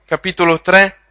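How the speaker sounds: usual speaking voice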